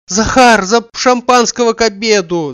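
high, loud call; shouting for the servant. A narrator's reading. Oblomov calls Zakhar to bring champagne, joyful loud shout. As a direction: shout joyfully to the next room. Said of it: "закричал он".

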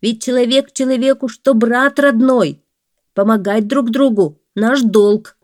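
man in the well begging the woodcutter; pleading man. A narrator's reading. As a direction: press the brotherhood plea hard.

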